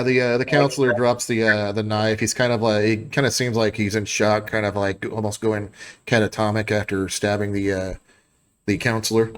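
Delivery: ordinary talk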